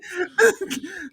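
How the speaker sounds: high wheezing laugh; high laughing wheeze; laughing fit. Real, not acted. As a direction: keep laughing, almost crying. Still laughing.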